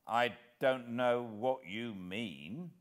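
gruff, slow, defiant denial; rough denial. Acted. Hero to the old villain, rough denial. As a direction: bluff it out, rough and stubborn. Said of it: "I said roughly".

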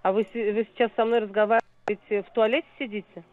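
usual speaking voice

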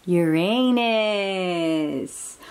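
drawn out, syllable by syllable; slow model word. Shown as a demonstration. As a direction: say Uranus slowly for the child to copy. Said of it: "Can you say it?".

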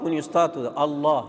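ordinary talk, nothing marked

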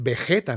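Vegeta said be-JE-ta; Spanish J sound. Shown as a demonstration. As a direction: say Vegeta with the g of Gerardo. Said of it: "Como Gerardo".

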